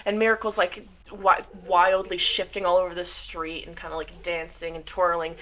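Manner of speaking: normal talking voice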